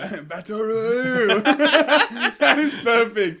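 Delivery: real laughter breaking through, loud and high; can barely get the words out for laughing. Real, not acted. Cracking up at a joke.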